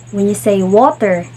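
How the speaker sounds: ordinary talk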